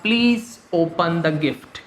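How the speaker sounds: Please open the gift spoken very slowly, word by word; slow model sentence. Shown as a demonstration. Teacher to students, dictating the model sentence very slowly. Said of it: "मैं क्या कहता?".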